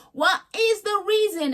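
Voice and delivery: high, slow, drawn-out [name] impression. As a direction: stretch the question like [name]. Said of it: "In my [name]'s voice".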